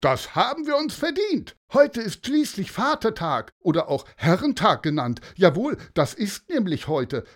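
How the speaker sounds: men on the beach shouting about Father's Day; rowdy shouting. Acted. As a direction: celebrate loudly, a bit tipsy. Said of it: "riefen der Reihe nach".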